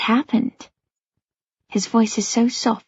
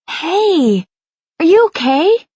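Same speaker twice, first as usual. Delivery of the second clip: higher, louder call to snap her out of it; louder, concerned; 8 semitones higher, 5 dB louder, slower than usual. A narrator's reading. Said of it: "Noah's voice is suddenly louder".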